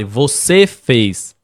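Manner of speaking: slow blaming "Você fez", the "Você" pointed and drawn out; accusing. Shown as a demonstration. Demonstrating to viewers the wrong way to tell a spouse "Você fez", slow and accusing. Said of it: "Não fale".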